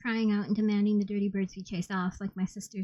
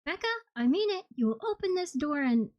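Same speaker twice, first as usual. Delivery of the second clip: much higher, firm demand; insistent friend; 8 semitones higher than usual. A narrator's reading. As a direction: insist through the door. Erskine insisting.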